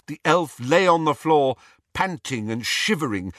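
plain reading voice

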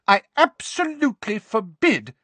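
high, loud, outraged mother; overwrought, emphatic prohibition; shouted ban. A narrator's reading. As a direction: forbid it at the top of your voice. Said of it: "shouted Missus Weasley".

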